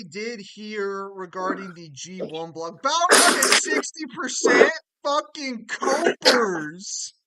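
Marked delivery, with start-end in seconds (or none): 2.8-7.1 loud, drawn-out furious yell; outraged yelling. Real, not acted. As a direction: rage at the poll, stretching it out. Outraged at poll result.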